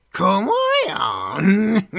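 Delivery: drawn-out, sneering question; slow menacing grin. Acted. (lassú, vigyorgó fenyegetés)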